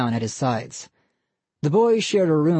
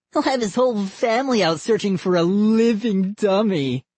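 put-on higher gleeful boy; mocking kid voice; 6 semitones higher than usual. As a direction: laugh at the victim. Boy character voice.

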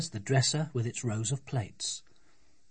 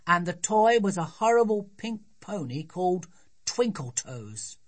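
put-on higher-pitched child's voice, scornful; indignant little girl; 6 semitones higher than usual. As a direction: tell on the toy like a disgusted child. Voices young Sophie.

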